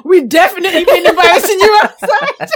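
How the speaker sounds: high, loud, through laughter; helpless laughter breaking into the words; laughing. Real, not acted. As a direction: crack up while telling it. Laughing at her mum's warning.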